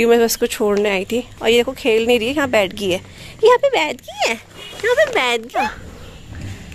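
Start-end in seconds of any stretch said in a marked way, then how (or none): 3.4-4.3 very high, louder cooing to the dog; a high baby-talk voice for the pet. Pretend. 4.3-6.7 very high, very slow cooing to the dog; drawn-out high baby talk to the pet. Pretend.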